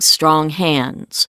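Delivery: ordinary delivery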